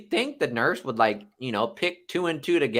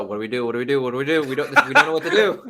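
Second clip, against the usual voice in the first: mock panic, laughing; 2 semitones lower, faster than usual.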